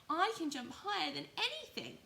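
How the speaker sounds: very high, loud insistent toy rabbit; squeaky loud defiant little rabbit. Acted. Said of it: "said the little rabbit".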